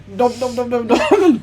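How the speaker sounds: comic chomping sound effect; greedy munching noises. Retold or imitated. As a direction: imitate wolfing food down noisily. Acts out gobbling brownies.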